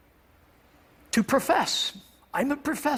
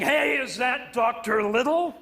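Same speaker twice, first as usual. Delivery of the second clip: slow, higher-pitched gravelly call; 4 semitones higher than usual. Retold or imitated. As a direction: imitate a gravelly-voiced man calling over slowly. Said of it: "gravelly voice saying".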